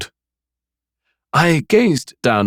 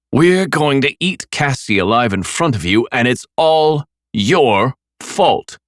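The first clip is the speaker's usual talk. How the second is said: cruel taunt; 6 semitones higher, slower than usual. A narrator's reading. Killer taunts Nick.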